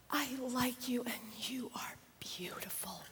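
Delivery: soft secret whisper; child whispering. Retold or imitated. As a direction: breathe it like a shy little boy. Grandson whispers to grandma.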